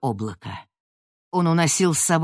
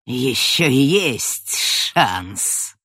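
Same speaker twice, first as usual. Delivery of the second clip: menacing vow; 2 semitones lower, much slower than usual. Acted. Villain Lazaria vows.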